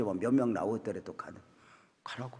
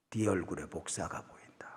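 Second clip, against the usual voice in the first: hushed, breathy call in the dark; late-night whisper; 7 semitones lower, 10 dB quieter than usual. Retold or imitated. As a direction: whisper so the others won't wake. (한밤중에 속삭이는 목사님 말투)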